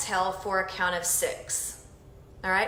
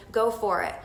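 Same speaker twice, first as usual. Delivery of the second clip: normal talking voice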